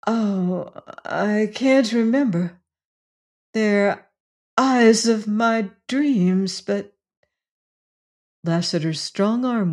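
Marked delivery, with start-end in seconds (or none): 0.0-7.0 halting, higher-pitched and tearful; slow, broken, dreamy distress; tearful bewilderment. A narrator's reading. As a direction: struggle to remember, close to crying. Bess struggles to remember, overcome.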